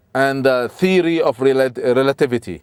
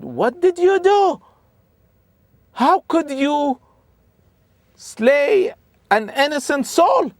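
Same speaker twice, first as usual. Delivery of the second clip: much higher, outraged and aghast, slowing on the last words; horrified outcry; slower than usual. Acted. Musa confronting Al-Khidr over the slain boy, horrified high cry. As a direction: cry out in disbelief at the crime.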